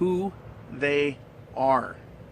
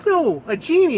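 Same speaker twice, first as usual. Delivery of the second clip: high, loud disbelief; astonished; 12 semitones higher, 8 dB louder than usual. Acted. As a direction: blurt it out, stunned by a genie. Woman astonished to meet a genie.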